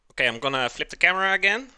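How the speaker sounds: normal talking voice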